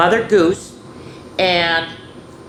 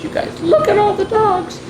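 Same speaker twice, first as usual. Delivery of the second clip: much higher, delighted exclaim; excited aside. Real, not acted. Reader to the kids, much higher and excited. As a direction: point out the dogs with delight.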